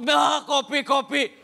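loud possessed demand; sulking possessed person. Acted. Possessed person throws a tantrum for coffee, sulking demand. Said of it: "Orang kesurupan lagi ngambek".

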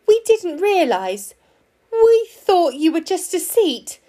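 high, loud startled apology; flustered fox. A narrator's reading. Fox apologising to a talking stone lion, flustered.

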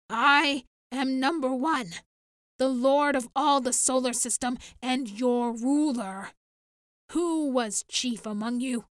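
loud, imperious proclamation; a tyrant declaring himself lord; commanding. Acted. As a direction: announce your rule to the village. Dictator claims rule over village.

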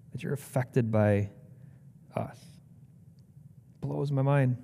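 hushed, unhurried praying voice; sincere prayer. Real, not acted. Preacher thanking God for Lazarus's story, prayerful. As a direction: speak humbly to God, pausing between thoughts.

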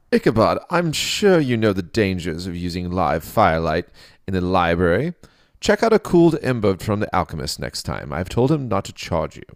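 high, loud scolding king; critical booming king. Acted. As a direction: scold like an amused monarch. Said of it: "The king critiqued".

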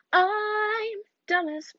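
very slow, high, goofy voice; high, slow, dopey voice; silly put-on voice. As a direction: drawl it like a cartoon dope. Self-insult in a put-on voice.

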